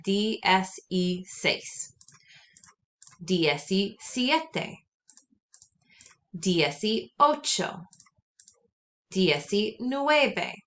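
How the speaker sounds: "D S E seis" spoken very slowly, each letter separate; slow spelled-out model. Shown as a demonstration. Teacher spells sixteen slowly for note-taking. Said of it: "you're saying each letter".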